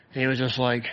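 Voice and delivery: ordinary talk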